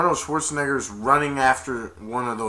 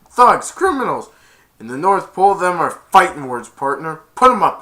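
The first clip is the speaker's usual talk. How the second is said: loud, raised mock threat; fighting challenge; 4 semitones higher, 10 dB louder than usual. North Pole Santa challenging his accuser, loud mock anger. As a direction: call him out for a fight.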